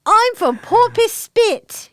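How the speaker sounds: put-on high, slow Australian accent. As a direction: ham up a broad Australian accent. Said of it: "I love Australian accents".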